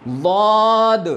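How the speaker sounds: ضاد with heavy d, very long vowel; drawn-out madd. Shown as a demonstration. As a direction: stretch ضاد slowly, full-mouthed. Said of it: "تھوڑا سا مد کے ساتھ پڑھتے ہیں". (لمبا، بھاری ضاد)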